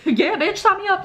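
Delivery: excited yes. Retold or imitated. Accepting the job offer.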